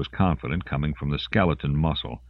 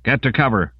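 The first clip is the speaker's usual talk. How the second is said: order; 6 semitones higher, 3 dB louder than usual. A narrator's reading. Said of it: "Intoko ordered".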